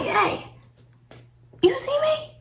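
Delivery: very high, sarcastic call for attention; exasperated call-out. Retold or imitated. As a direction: squeak it up to get noticed. Retells calling out to distracted agent.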